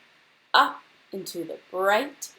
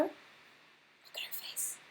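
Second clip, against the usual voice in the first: whispered aside; 19 dB quieter, slower than usual. Real, not acted. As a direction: hush it to the listeners. Teacher whispers to listening kids.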